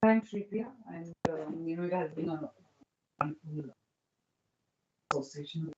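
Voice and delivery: faint and distant, far from the mic; barely audible. Real, not acted. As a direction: speak too far from the microphone. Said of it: "your voice is very low".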